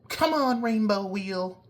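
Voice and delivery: squeaky high-pitched plea; high squeaky urging. Real, not acted. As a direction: coax it in a tiny high voice. Urging software to load.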